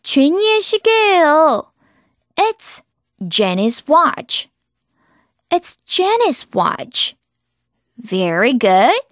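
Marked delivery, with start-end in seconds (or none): 2.3-4.5 very slow, clear example; slow drill. Shown as a demonstration. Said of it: "example drill". 5.5-7.1 very slow, clear example; slow drill. Shown as a demonstration. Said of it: "example drill".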